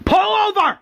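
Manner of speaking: high-pitched acted scream. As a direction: scream the order as the furious governor. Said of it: "that's where the governor screams".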